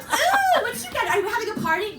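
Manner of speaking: high, sugary nosy mom voice; nosy lady. Retold or imitated. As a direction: gush like a nosy stranger in line. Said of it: "she's like".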